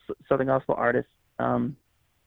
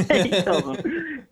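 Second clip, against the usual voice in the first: laughing; 6 semitones higher, much slower than usual. Real, not acted. Laughs while telling the twist.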